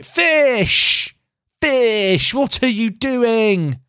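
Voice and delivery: high, exasperated call of the name, then a slow drawn-out question; exasperated yell at player. Real, not acted. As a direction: throw your hands up at a blundering player. Yelling at his player on screen.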